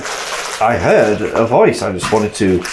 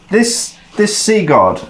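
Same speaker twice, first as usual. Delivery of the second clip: eager question; 8 semitones higher than usual. Acted.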